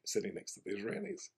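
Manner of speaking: ordinary delivery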